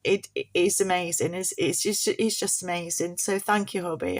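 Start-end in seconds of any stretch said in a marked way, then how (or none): none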